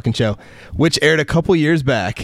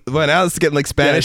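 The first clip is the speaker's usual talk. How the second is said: ordinary talk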